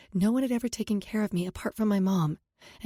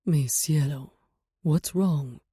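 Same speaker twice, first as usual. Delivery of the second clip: lower, tender concern; gentle; 6 semitones lower, slower than usual. A narrator's reading. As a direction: boyfriend comforting his crying partner, gentle. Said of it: "in a gentle tone".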